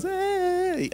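very high, drawn-out squeal; high baby-talk greeting. Retold or imitated. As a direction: imitate a delighted squeaky Hey. Imitates reaction to baby held up.

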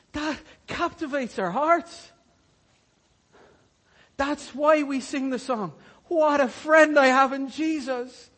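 preacher exulting that Jesus calls him friend, loud; joyful preaching. Real, not acted. Let the joy break into near laughter and tears.

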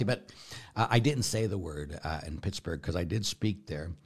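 normal talking voice

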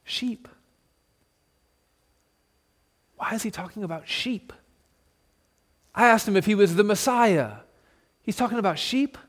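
hushed, puzzled murmur from the crowd. Acted. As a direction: mutter it under your breath, baffled. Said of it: "you can hear the crowd begin to murmur".

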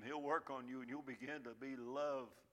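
ordinary delivery

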